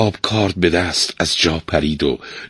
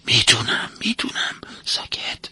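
very soft whisper; quiet hushing; 4 semitones higher, 8 dB quieter than usual. A narrator's reading. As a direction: whisper to silence someone about to speak. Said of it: "آروم گفت".

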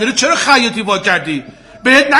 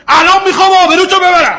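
higher, raised-voice threat; angry shouting; 7 semitones higher, 4 dB louder than usual. Acted. Customer threatening to shame the tailor, angry shouting. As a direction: threaten him, voice climbing.

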